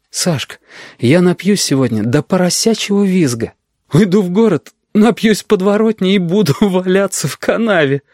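high, giddy relief; elated. A narrator's reading. Denis elated after passing exam.

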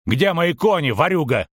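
high-pitched, accusing demand; angry demand. A narrator's reading. Halfling threatens the thief.

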